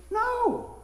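high startled no; disbelieving no; high yelp of disbelief; surprised no. Retold or imitated. Retold surprise at diagnosis.

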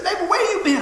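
lightly voiced grocery clerk; cashier greeting. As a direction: greet him like a long-lost regular. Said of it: "She said".

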